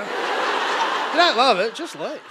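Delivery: slow, drawn-out inspiring delivery; motivational slogan. Shown as a demonstration. Comic reciting the slogan as pep talk, slow and grand. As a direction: sell it like a life coach with a laugh. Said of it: "starts to become motivational".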